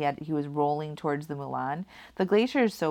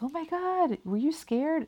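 excited; 12 semitones higher than usual. Retold or imitated. Friends asking her excitedly.

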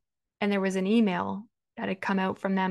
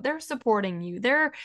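ordinary delivery

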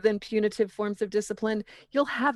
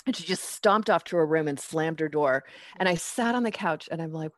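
ordinary delivery

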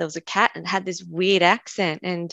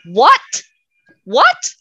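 high, loud puzzled "What? What?"; startled dog; 8 semitones higher, 9 dB louder than usual. Retold or imitated. Deaf dog startled by its owner's question, high loud confused cry. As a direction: play the dog as a surprised, squeaky yelp. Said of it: "the animal turned around and went".

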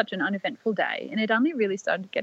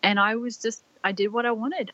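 ordinary delivery

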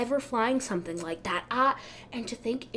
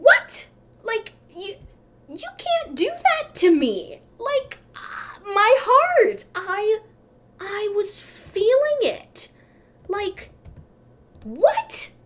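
high-pitched, wounded disbelief; mock outrage; 11 semitones higher, 6 dB louder, slower than usual. Passenger protesting to the gate agent, mock outrage. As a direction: protest at a much higher pitch, hammed-up. Devastated at losing the seat.

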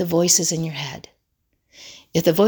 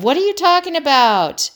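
mock defensive; 9 semitones higher, 4 dB louder than usual. Parent brushing off daughter's complaint, mock defensive. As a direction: act out exaggerated offended denial.

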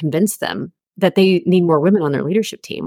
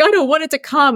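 desperate plea; 10 semitones higher than usual. Retold or imitated. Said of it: "that's the moment in your life where".

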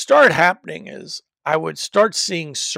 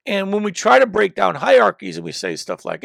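usual speaking voice

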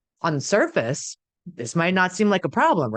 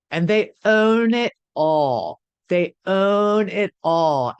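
"they own it all" slow, higher and louder; slow stress; 4 semitones higher, 4 dB louder, much slower than usual. Shown as a demonstration. Speaker stresses to viewers how they own it all, slow and drawn out. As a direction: drag out "own" with a falling tune. Emphatic repeat of the point.

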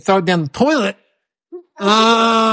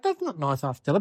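quiet reproach; 5 semitones lower, 10 dB quieter than usual. Retold or imitated. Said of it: "It's like".

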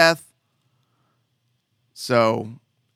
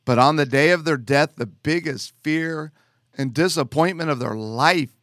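ordinary talk, nothing marked

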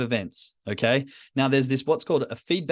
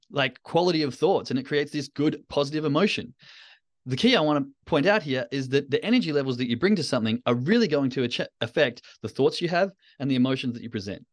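ordinary talk

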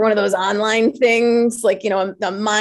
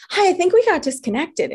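lightly mimicked bubbly caller, higher; cheery caller; 9 semitones higher than usual. Caller ringing back after the hang-up, mildly mimicked cheery and higher. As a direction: chirp it back like she's delighted. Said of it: "And she was so happy".

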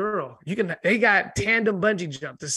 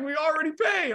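high niece voice; 9 semitones higher than usual. Retold or imitated. Niece quoted.

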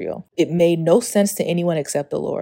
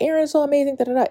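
high, gushing praise voice; mock a fawning crowd; 10 semitones higher, much faster than usual. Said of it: "It's not like".

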